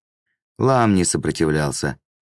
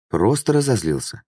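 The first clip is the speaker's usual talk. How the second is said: higher, timid excuse; fearful; 6 semitones higher than usual. A narrator's reading. Swindler placates the demon, mildly fearful. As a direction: excuse yourself with a touch of fear. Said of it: "в страхе ответил он".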